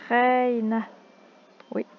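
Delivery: ordinary delivery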